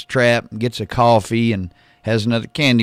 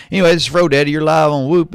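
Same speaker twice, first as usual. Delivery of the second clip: radio announcer; 5 semitones higher, 6 dB louder, faster than usual. Acted. Radio host station ID.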